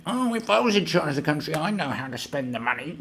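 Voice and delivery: high put-on voice of a clueless critic; silly high caricature; mocked know-it-all. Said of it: "There's people, like, going".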